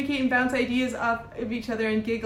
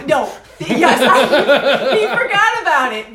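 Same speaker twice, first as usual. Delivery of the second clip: cracking up while insisting; laughing protest; 7 dB louder, slower than usual. Real, not acted. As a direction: protest loudly through real laughter. Laughing protest over partner's forgetting.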